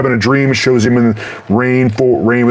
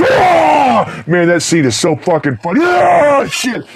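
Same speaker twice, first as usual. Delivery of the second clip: excited shout; 11 semitones higher, slower than usual. Real, not acted. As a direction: whoop with real delight. Laughing at the remembered scene.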